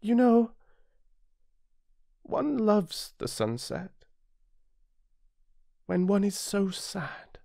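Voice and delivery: put-on high, slow boyish voice, wistful; a lonely small prince tells his friend slowly; little prince, wistful. Narrator voices the little prince, high, slow and wistful.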